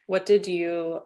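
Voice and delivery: ordinary talk, nothing marked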